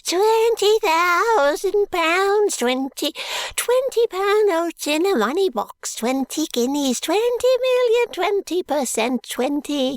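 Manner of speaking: very high, loud, babbling crone; shrill crone babbling about money; jabbering old woman. A narrator's reading. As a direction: rattle off the numbers like a half-mad old wife. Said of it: "is instantly aroused and jabbers".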